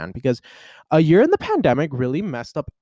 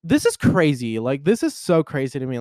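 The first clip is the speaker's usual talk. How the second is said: normal talking voice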